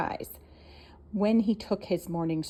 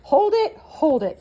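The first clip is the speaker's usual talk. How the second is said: high, loud plea to stop; crying out; 7 semitones higher, 6 dB louder, slower than usual. Acted. Dudley stopping the crowd, crying out loud. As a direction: cry out to halt the shouting crowd. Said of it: "cried Dudley".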